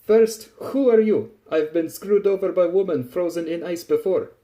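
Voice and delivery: loud, high challenge in character; Tachanka, suspicious. A narrator's reading.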